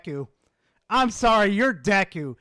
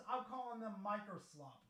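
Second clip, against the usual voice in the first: ordinary talk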